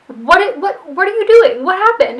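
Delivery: high, loud alarmed questions; startled alarm. Retold or imitated. Mother walks in on a freezer lockup, alarmed. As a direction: sound caught off guard by the mess. Bunny finds the chef locked up.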